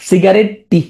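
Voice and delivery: সিগারেট, then a separate "টি"; spelling pronunciation. Shown as a demonstration. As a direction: sound out the last letters as টি. Teacher sounds out the spelling. (বানান ধরে "সিগারেট-টি")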